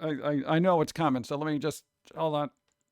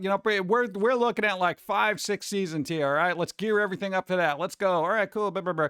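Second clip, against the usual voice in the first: ordinary delivery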